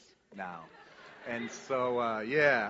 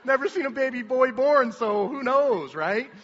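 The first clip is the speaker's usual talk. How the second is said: much higher and louder, flustered and defensive; flustered excited reply; 12 semitones higher, 13 dB louder than usual. Retold or imitated. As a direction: blurt it out like an embarrassed, overexcited dad, slowing on the middle lines. Dad flustered after midwife's correction.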